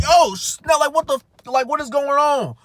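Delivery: high, loud startled "oh snap, what is going on"; panicked surprise. Retold or imitated. Young driver reliving the crash, shocked and loud. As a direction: freak out at the sudden thud.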